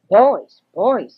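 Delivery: put-on loud, calling out to the boys; father calling out. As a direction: call them sharply from the doorway. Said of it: "he called".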